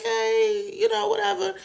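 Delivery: way up high, awkward and shy; high, timid brush-off. Retold or imitated. Penn voiced shy, high.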